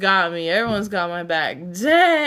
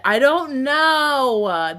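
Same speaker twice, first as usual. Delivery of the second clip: quoting judge; 5 semitones higher, 5 dB louder, much slower than usual. Retold or imitated. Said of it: "And she said".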